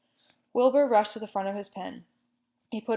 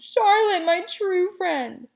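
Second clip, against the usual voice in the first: high, loud, slow wail; grieving wail; 11 semitones higher, 9 dB louder, much slower than usual. A narrator's reading. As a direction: sob out in grief. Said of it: "he moaned".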